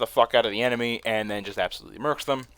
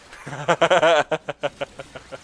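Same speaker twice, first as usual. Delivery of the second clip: rapid laugh, pitched up, fading out; long chuckling laugh that winds down; amused laugh; 4 semitones higher, 5 dB louder than usual. Real, not acted. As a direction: crack up at your own remark. Laughs at own interruption story.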